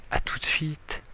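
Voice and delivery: breathy whispered goodbye. Acted. Host whispering see you soon to viewers before cutting.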